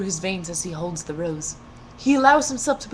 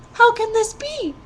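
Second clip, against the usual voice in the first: put-on breathless girl; 13 semitones higher, 7 dB louder than usual. A narrator's reading. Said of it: "she breathed".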